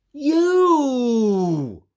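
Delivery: high loud cry of delight; joyful reveal. Acted. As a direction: point at your friend with joy. Reveals missing important part.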